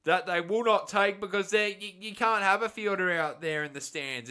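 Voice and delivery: loud, high burst of live commentary; excited commentary call. Real, not acted. Commentator calling a big hit to viewers, loud and excited.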